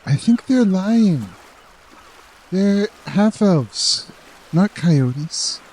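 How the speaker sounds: put-on high childlike aside; child voice. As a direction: tug his robe and confide. Child whispers doubts.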